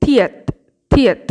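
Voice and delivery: থিয়েত opened with t and th fused; t-th blend. Shown as a demonstration. Teacher modelling the next letter, t-th blend. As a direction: say থিয়েত crisply separate, aspirated first sound, falling end. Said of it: "তয় থ একসাথে উচ্চারণ করুন".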